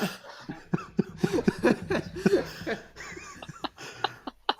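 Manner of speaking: shrieking high laugh. Real, not acted. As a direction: burst out in a squealing laugh. Big laugh after bit.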